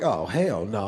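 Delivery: muttered dread at the call; low muttered aside. Retold or imitated. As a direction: grumble it to yourself. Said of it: "so I was like".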